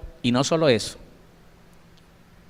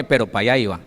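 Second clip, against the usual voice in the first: ordinary delivery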